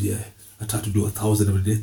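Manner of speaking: low, hushed smug brother; a quiet, low-voiced self-satisfied devotee; smug Sufi brother. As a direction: answer quietly, full of yourself. Acted Sufi brother answering.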